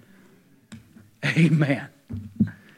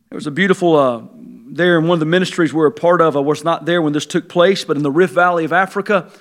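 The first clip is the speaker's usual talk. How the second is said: normal talking voice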